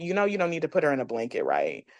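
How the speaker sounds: low, soft, quick aside; imitated cold midwife. Midwife to grieving mother about the blanket, imitated quiet correction. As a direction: mimic a hushed know-it-all correction.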